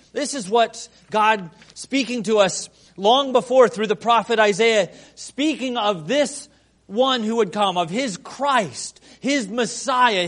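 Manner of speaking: rising pulpit intensity; full-voiced preaching. Real, not acted. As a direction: drive the point home to the congregation. Preaching build on Isaiah.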